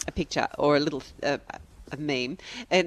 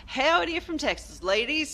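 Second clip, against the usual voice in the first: put-on Texan accent; 9 semitones higher, 3 dB quieter than usual. Host reading listener's email as a Texan, high Texan accent. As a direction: put on a playful Texan twang. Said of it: "read this aloud or in your head in an accent".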